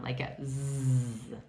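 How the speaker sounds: slow, buzzing "z" with voice; z sound. Shown as a demonstration. Teacher demonstrating the voiced "z". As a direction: let the "z" buzz. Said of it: "it has a Z sound".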